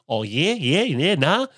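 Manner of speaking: put-on Australian accent, rising high at the end; Carl Barnard. Host imitates an Australian comedian's persona, swooping Australian accent. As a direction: swoop up through an Australian comic's drawl. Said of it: "He's got that very".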